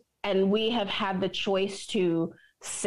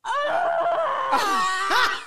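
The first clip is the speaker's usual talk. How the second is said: high, loud mock wail from the yoga stretch; imitated wailing yell; 4 dB louder than usual. Showing her friend how she hollered in yoga, a loud high wail. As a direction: let out a loud cry mid-stretch. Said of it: "it was just like".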